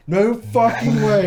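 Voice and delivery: high, loud, drawn-out amazement; retold disbelief. Friend is shown the mouse, loud incredulous reaction. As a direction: stretch it out in mock astonishment.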